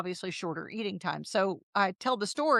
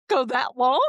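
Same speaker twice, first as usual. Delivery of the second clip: shocked disbelief; 4 semitones higher, 7 dB louder than usual. Retold or imitated. Retold shock at fasting instructions.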